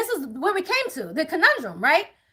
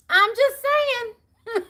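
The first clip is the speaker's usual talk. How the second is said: sly drawn-out aside; 6 semitones higher, much slower than usual. Real, not acted.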